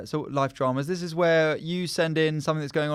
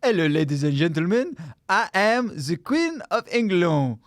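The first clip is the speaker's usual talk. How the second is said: mock French Queen; 4 semitones higher, 4 dB louder than usual. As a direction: ham up the French accent. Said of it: "She French?".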